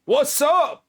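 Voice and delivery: loud, high bro voice; jock. Acted. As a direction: greet him like a pumped-up bro. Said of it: "let me put my jock voice on here".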